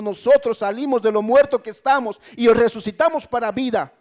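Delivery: high, loud sermon at full force; fiery preaching. Acted. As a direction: preach it out, driving each phrase. (predicación encendida a todo volumen)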